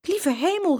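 high-pitched cry of surprise; startled exclamation. A narrator's reading. Uncle hearing shouts from his garage, exclaiming. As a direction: exclaim it, taken aback. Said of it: "riep oom Freddy uit".

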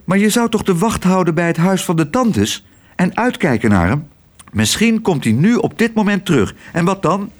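high, lightly voiced anxious child figure; worried Otje. A narrator's reading. Otje questions sparrow about watching for her father, worried.

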